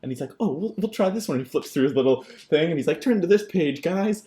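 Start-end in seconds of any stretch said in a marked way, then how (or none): 0.4-1.4 higher, bright teacher impression; cheerful teacher voice. Teacher voiced in retold story. 3.0-4.2 high, loud teacher call; eager teacher. Retold or imitated. Teacher voiced in retold story.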